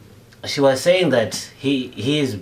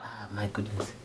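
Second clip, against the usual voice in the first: whispered, weary sigh; whispering; 6 semitones lower, 15 dB quieter than usual. Acted. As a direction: breathe it out in a whisper. Dad confides after kid's outburst.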